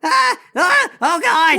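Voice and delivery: high-pitched panicked cries; panicked screams. Acted. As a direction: cry out as if a dog lunges at you. Attacked by a lunging dog.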